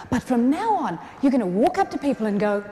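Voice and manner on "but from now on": ordinary talk, nothing marked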